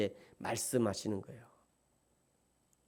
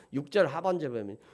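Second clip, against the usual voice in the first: ordinary delivery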